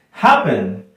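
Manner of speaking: "Happen" loud, HAP-pen, punched at the start; stress on first syllable. Shown as a demonstration. Teacher asks students where the power is, "Happen" stressed loudly up front. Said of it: "¿Dónde está el stress?".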